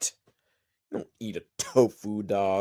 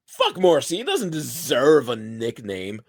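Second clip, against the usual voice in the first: ordinary talk, nothing marked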